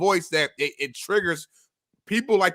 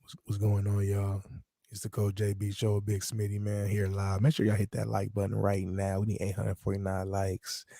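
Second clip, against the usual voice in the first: mock ASMR voice; 6 semitones lower than usual. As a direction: hush it down like a YouTube ASMR girl, half in jest. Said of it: "they be talking like this".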